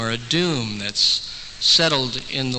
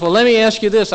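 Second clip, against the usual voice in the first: higher, louder exasperated retelling; very frustrated; 6 semitones higher, 6 dB louder than usual. Retold or imitated. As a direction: push the question out in exasperation. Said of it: "Very frustrated at this point".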